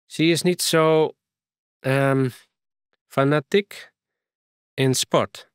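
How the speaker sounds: put-on light English accent in broken Frisian; a foreign girl speaking mixed English and Frisian. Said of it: "Ze praten nogal mooi een accent". (licht Engels accent)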